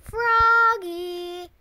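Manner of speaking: calling out, high and loud; loud drawn-out call. Acted. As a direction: call his name across the house. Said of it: "called his mother".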